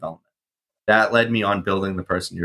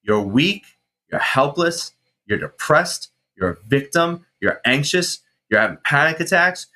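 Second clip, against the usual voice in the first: higher, half-yelled scolding of himself; mock-angry mirror rant at himself; retold self-yelling; 6 semitones higher than usual. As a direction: berate your own reflection, pitched up. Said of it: "yelling almost at myself".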